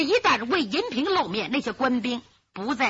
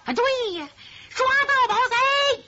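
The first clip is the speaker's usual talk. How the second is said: soldiers' shout; 13 semitones higher, 4 dB louder than usual. Acted. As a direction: bellow it like a soldier rousing the troops. Soldiers shout agreeing to arrest. (高声拖长喊叫)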